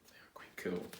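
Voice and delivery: whispered aside; a soft secret whisper. Acted. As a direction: say it under your breath.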